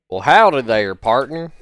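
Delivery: loud, twangy western howdy with the pitch rising on partner; cowboy voice. Acted. Streamer greeting chat as a hammed-up cowboy, loud. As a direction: greet them like a hammed-up ranch hand. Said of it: "I can't do a great, uh, cowboy voice".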